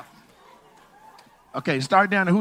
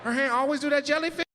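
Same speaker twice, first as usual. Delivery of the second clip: higher, amused gossiping coworker voice, with a laugh; mock a nosy coworker giggling about her hand; 8 semitones higher, 4 dB quieter than usual. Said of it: "You saw her at work like".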